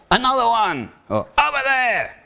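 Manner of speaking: mock market crier. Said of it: "the crew and pitcher shout out the nature of the bargain".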